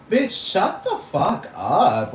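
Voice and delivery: slow, high, drawn-out telling off; angry retort. Real, not acted. Ranting vlogger telling the fashion industry off, slow and high. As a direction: spit it out slowly, high and fed up.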